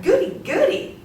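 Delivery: high, loud squealed goodies; giddy delight. Acted. Joan gushes over another generous committee check. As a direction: gush like a delighted child.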